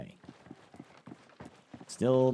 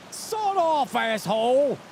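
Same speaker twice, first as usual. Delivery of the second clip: high, loud mock yell of an angry NPC; hostile NPC; 10 semitones higher, 4 dB louder, much slower than usual. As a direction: bark it like a rude game character. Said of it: "the N P C would look at you and be like".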